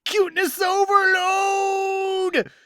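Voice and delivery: high, drawn-out gushing shout; overwhelmed fangirl squeal; cuteness overload squeal. Real, not acted. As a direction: squeal it like you can't handle the cute. Gushing over a cute scene.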